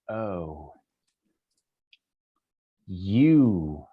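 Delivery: low villainess, savouring each word; menacing drow lady. Acted. Drow lady looks at her enemy, low drawn-out menace. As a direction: drop low and linger with menace. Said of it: "she'll look at you and she goes".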